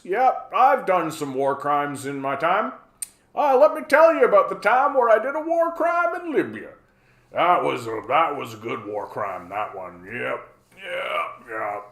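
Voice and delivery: casual, fond reminiscing in a put-on old hawk voice; John Bolton impression. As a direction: breezily confess as a mock war hawk. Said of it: "casually admit to just war crimes".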